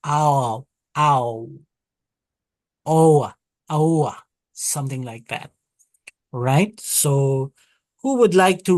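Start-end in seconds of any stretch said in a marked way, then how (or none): 0.1-4.2 "ow" then "oa", rounded and drawn out; kamatz sound. Shown as a demonstration. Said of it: "it is the kamat, so it's".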